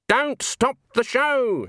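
high, slow and firm; stern old woman. Acted. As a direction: command it slowly. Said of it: "said Granny".

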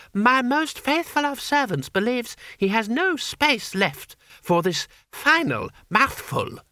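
put-on high, silky-polite child ruler; high-pitched imperial mockery; menacing boy Emperor. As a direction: threaten through courtly politeness.

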